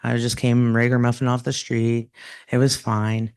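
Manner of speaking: normal talking voice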